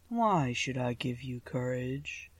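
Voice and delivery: low, slow figure of power; demanding Oz. A narrator's reading. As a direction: make the demand slowly, deep in the voice. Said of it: "demanded Oz".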